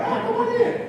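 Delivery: high, light professor voice; Doctor Scott's voice. Retold or imitated. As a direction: greet him like a cheery old professor. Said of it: "That's how he talked".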